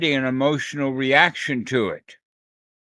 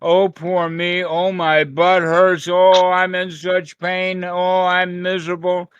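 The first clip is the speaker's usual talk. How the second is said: self-pitying victim; 3 semitones higher, 3 dB louder than usual. Shown as a demonstration. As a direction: moan it like a poor victim. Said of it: "the attitude of".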